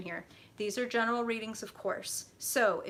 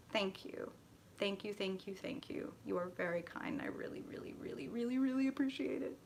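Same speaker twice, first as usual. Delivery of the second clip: murmured thanks, much softer, sliding into a whisper; soft grateful thanks; 8 dB quieter than usual. Real, not acted. Reader to Lori, thanking her for the card in a much softer near-whisper. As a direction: thank her so softly it's nearly breathed.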